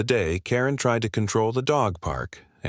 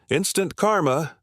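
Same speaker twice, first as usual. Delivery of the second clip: higher-pitched, restrained narrated shout; mock shout; 7 semitones higher than usual. A narrator's reading. An onlooker heckling Karen after her fine, a mild shout. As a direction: call it out high, without much volume. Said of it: "Someone shouted".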